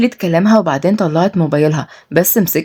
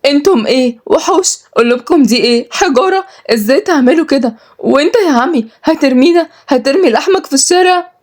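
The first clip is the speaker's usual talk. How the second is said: high, anguished reproach; tearful; 8 semitones higher than usual. Acted. Niece reproaches uncle throwing them out, tearful. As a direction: plead in disbelief, voice pitched way up. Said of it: "ردت ملاك بدموع".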